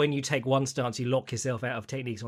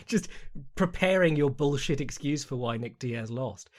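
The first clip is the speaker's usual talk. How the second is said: ordinary talk, nothing marked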